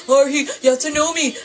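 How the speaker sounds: high, loud mock pleading; hammed-up frantic plea; desperate plea. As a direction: imitate a desperate cry to be recognized.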